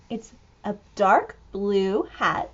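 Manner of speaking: ordinary delivery, nothing marked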